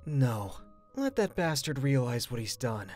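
low, grim and soft-spoken; cold refusal. Acted. Sibling refusing to stop sister, cold low refusal.

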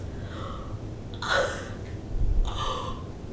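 a long soft gasp of wonder; awed gasp. Real, not acted. Touring the bathroom, soft awed gasp. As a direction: gasp quietly in amazement.

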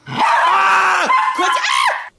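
high startled cry; startled yelp. Real, not acted. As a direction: yelp as the dog jumps. Dog lunges, startled cry.

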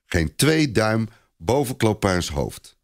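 ordinary delivery

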